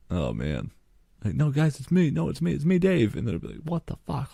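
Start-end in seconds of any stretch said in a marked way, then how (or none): 1.3-3.1 much higher, insistent "it's me"; high-pitched plea, no, it's me, Dave; pleading to be recognized. Pretend. Pleading coworkers as a lion. 3.6-4.3 much higher, hushed mock disbelief; high, soft stunned reaction; shocked coworkers.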